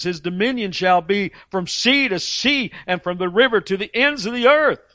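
high, loud proclaiming of scripture; preacher proclaiming the coming king; preaching proclamation. Acted. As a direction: proclaim it to the crowd. Proclaiming Zechariah prophecy.

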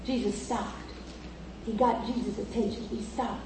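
suddenly soft and low; hushed storytelling. Acted. Preacher tells how Jesus stopped, hushed. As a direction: drop to a quiet, weighty narration. Hush after the shouted climax.

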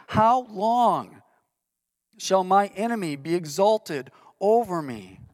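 measured, weighty psalm reading; solemn scripture reading. Acted. Pastor reading David's lament psalm to the congregation, solemn. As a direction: read each "how long" as a heavy question.

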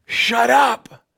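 high-pitched yelled command; yelling. Acted. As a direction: shout down the beggar. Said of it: "many people yelled at him".

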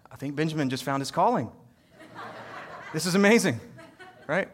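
laughing in delighted disbelief. Retold or imitated. Dad amazed that his son's idea calmed the baby, laughing. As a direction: let the laugh run through the words. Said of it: "I'm like".

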